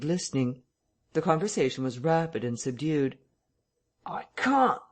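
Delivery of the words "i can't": high-pitched vicar's protest; voice rising. A narrator's reading. Vicar arguing inside the parlour, voice rising in protest. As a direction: push the pitch up as you refuse. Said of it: "his voice rising".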